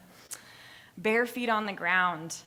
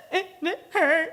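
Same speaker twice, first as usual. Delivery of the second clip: put-on gasping, high, loud sobbing child voice; crying child; 12 semitones higher, 9 dB louder than usual. Hurt daughter to her parent, sobbing high and loud. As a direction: gasp and sob it out like a hurt little girl.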